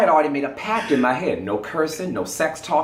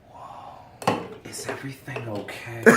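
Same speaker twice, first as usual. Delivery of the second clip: stunned cashier; 2 semitones lower, 13 dB quieter than usual. Retold or imitated. Cashier voiced, shocked.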